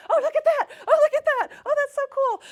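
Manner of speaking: high, loud, giggly exclamations; excited gushing. Retold or imitated. Walking the mall with a friend, gushing loudly with glee. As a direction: gush over everything like a wide-eyed kid. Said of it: "me going".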